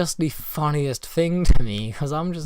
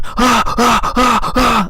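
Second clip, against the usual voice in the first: loud, high-pitched mock screams; retold screaming; 11 semitones higher, 10 dB louder, slower than usual. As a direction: imitate a friend's repeated screams, pitched up and loud. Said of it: "like, these really loud screams".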